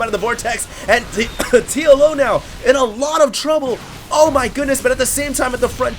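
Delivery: loud, high caster hype; excited. Real, not acted. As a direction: keep the energy up through the chaos. Fight continues, caster hyped.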